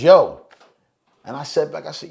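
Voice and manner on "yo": loud, high, single-word hail. Retold or imitated. Retelling how King approached him, loud hail. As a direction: call out a sharp, attention-getting greeting. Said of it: "It was".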